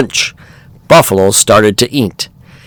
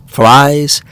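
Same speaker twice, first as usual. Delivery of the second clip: plain reading voice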